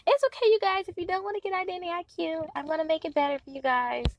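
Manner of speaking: high, soothing mock-nice voice; sweet pushover voice. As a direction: play the overly accommodating helper. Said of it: "I usually be like".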